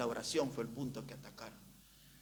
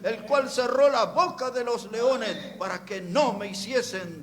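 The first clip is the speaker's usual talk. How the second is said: loud, high, slow scripture reading; preaching at full force; 8 semitones higher, 13 dB louder, slower than usual. Acted. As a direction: proclaim Daniel's reply to the king. (predicando la escritura con fuerza)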